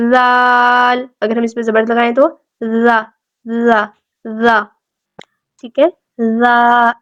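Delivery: زا said softly, tongue between the teeth; soft dhal. Shown as a demonstration. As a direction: voice زا gently. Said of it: "زال کی ادائیگی میں".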